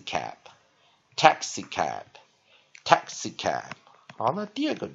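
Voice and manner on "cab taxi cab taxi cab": "taxi cab" blended back together, slow and measured; short-weak-short stress. Shown as a demonstration. Teacher drilling the finished word, "taxi cab" slow with short-weak-short stress. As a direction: repeat "taxi cab" slowly, middle syllable weak. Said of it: "它是short，weak，short的组合".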